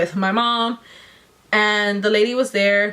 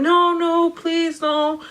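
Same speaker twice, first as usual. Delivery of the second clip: high, drawn-out plea, imitating a frightened child; mock pleading, pitched well up; retold tearful pleading; 7 semitones higher, slower than usual. Patient begs staff not to staple, retold pleading. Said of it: "I start crying. I start telling them".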